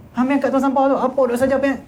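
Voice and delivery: high-pitched sharp telling-off; angry scolding. Acted. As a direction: demonstrate scolding someone by the bin. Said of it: "Marah tu". (contoh teguran marah)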